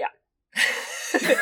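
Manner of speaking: real uneasy laugh; nervous laughter. Real, not acted. Host bracing co-host for a disturbing account, laughing nervously. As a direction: laugh as a defense mechanism. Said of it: "I'm laughing because that's just the defense mechanism".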